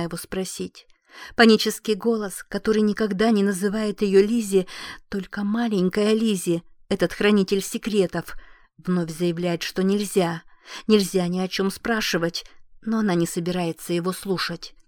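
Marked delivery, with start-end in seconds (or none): none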